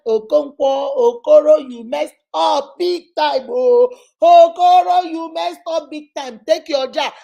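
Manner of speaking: loud, high, drawn-out mad outburst; venting self-talk. Shown as a demonstration. Counsellor demonstrates venting at an absent offender, loud angry self-talk. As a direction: shout it out to get the anger out. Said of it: "Talk to yourself in your house".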